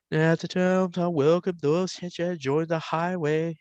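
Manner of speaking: high eerie backwards voice; imitating backwards voice. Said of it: "I'm gifted at that".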